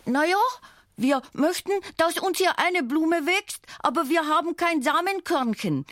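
hoch und etwas lauter gesprochen; hohe Zwergenstimme. A narrator's reading. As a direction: eine kleine Figur mit heller Stimme. Schlaumielek spricht, hohe Figur. (hohe Zwergenstimme)

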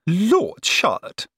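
high, slow, tart retort. A narrator's reading. Cousin retorts to the prim sister, tartly. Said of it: "said Missus Malfrey tartly".

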